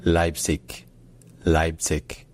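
Leipzig ending in a hard k; southern German pronunciation. Shown as a demonstration. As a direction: say Leipzig as "LYPE-tsik", stress first. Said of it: "dans certains dialectes du sud de l'Allemagne".

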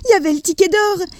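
put-on high, quick, breathless child's voice; a boy rushing out his big news, high and fast; excited young Charlie. Charlie tells his mother about the ticket, high and fast.

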